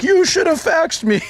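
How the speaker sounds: very high, slow, drawn-out mock monk cry; mock monk shout. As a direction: wail it after him like the monk. Monk calling after him.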